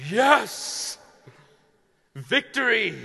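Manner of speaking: high, loud mock cheer of triumph; cheering muscles. Muscles cheering after a good stretch, loud and high. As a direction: cheer like your muscles just won.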